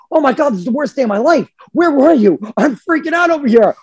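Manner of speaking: high, fast panicked dog voice; dog pouring out its panic to the returning owner; frantic dog. Retold or imitated. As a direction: gabble it like a panicking pet. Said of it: "your dog is literally looking at you like".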